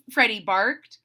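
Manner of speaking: ordinary delivery, nothing marked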